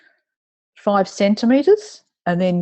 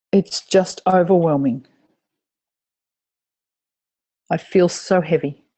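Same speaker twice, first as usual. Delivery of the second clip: tapping reminder phrases. Acted. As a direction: lead the group through each point with mild voiced distress.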